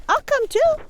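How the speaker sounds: high squeaky little rabbit asking. Acted. Rabbit joins.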